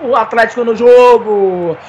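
slow, shouted goal celebration; explosive goal commentary. Acted. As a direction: savor every word of the goal. (narração de golaço)

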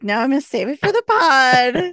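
played-up inner voice; mock self-restraint. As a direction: loudly tell yourself to wait. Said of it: "I was like".

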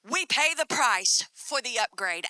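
ordinary talk

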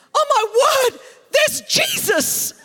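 loud, very high starstruck yell, slowed; yelled across restaurant. Retold or imitated. Starstruck fan spotting Jesus actor across a restaurant, shrieking. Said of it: "I yelled across the restaurant".

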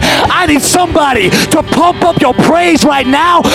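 rally the crowd, preaching hard; fiery preaching. Acted. Preaching at full force.

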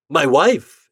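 startled at the sight of her; husband caught off guard by his wife; surprised. Acted. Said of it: "exclaimed Armand in surprise".

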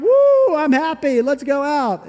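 high, loud whoop over a fat bank account; mock thrilled whoop. Pastor acting someone with money in the bank, high loud whoop. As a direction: squeal like you just got paid.

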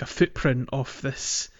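ordinary delivery

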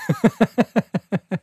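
high burst of real laughter; laughing at a bad film list. Real, not acted. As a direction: crack up at the friend. Laughs at friend's film taste.

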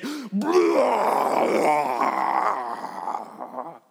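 loud outraged blow-up cry; mock outraged shout. Preacher acting a husband's overkill blow-up, loud outburst. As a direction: erupt like the Kraken unleashed. Unleashing the Kraken.

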